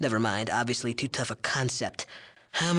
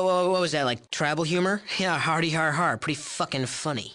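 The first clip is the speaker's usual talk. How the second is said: normal talking voice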